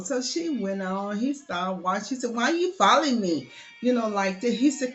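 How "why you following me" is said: louder, higher retold challenge; indignant challenge. Girl confronts the man following her, mock indignant. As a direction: push back at him, raised voice.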